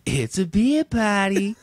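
high, drawn-out dad impression; dad catchphrase, Massachusetts accent. Replaying dad's line over a beer, exaggerated accent. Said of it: "think in my head, like".

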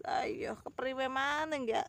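usual speaking voice

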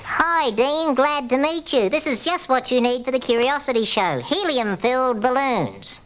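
high squeaky voice from inhaled helium. Real, not acted. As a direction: talk with a lungful of helium. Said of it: "because my lungs and windpipe and throat are filled with this gas helium".

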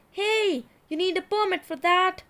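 high, loud officious shout. A narrator's reading. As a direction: bellow it after the runaway zebra.

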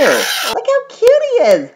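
slow, high adoring coo. Real, not acted. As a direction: gush over the cute little bear. Cooing over cute koala.

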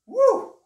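loud high whoop of victory; triumphant whoop. Real, not acted. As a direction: whoop after the last rep. Finishing the set.

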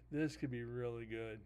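words through a yawn. Real, not acted. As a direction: talk mid-yawn. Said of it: "Why am I yawning so much?".

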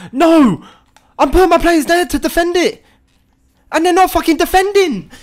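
high, loud furious rant; furious yelling. Real, not acted. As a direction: scream at the useless defenders. Ranting at his defenders.

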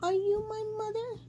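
put-on high, small baby bird asking; a lost little chick asking the hen. A narrator's reading. Little bird asks the hen, high and small. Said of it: "he said to the hen".

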